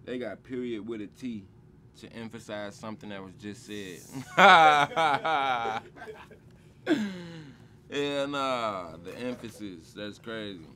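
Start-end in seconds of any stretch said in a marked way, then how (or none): 4.1-7.8 loud amused laugh; loud real laugh. Real, not acted. Rapper laughing at period with a T, loud laugh.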